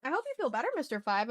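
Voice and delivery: ordinary talk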